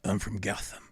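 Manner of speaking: low, hushed Batman voice. Retold or imitated. Star answering an extra in character, low Batman voice. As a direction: drop deep and quiet, staying in character.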